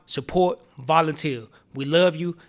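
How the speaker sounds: ordinary delivery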